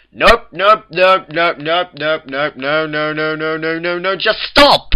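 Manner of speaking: high, loud rapid-fire refusals building to a yell; exasperated shouting. A narrator's reading. Twilight interrupts the Cakes' story, exasperated shouting. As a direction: shout it down until you explode.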